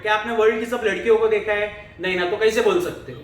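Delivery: normal talking voice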